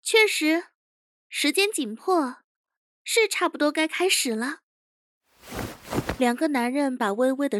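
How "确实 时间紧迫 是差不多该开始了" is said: higher character voice, measured phrases; higher-pitched young chief; composed interrogation chief. Acted. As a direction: agree coolly and get down to business.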